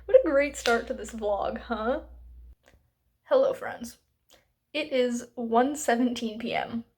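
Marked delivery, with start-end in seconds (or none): none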